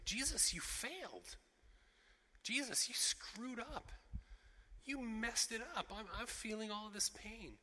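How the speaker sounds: pained accusation. Acted. Grieving mourners blaming Jesus, pained and accusing.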